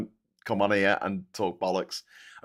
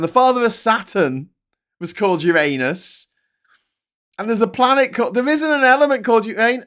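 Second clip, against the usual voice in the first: much higher and louder, outraged disbelief; incredulous rant; 11 semitones higher, 6 dB louder than usual. Real, not acted. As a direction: rant like you can't believe it. Ranting about chatbot's wrong list.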